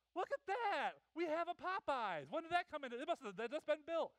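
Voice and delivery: high, surprised exclaim; mock amazement; amazed passenger. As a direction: point out the window in wonder. Passenger amazed by new store.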